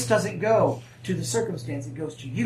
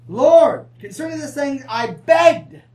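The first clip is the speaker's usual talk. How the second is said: louder, higher pleading cry to the Lord; mock begging Paul; 5 semitones higher, 7 dB louder, slower than usual. Preacher voicing Paul begging God, mock pleading cry. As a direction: play Paul crying out, half-hearted beg. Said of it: "Ah, Paul doesn't beg".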